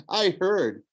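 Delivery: mocking laugh through the words. A narrator's reading. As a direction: laugh him off, amused. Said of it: "Luke laughed".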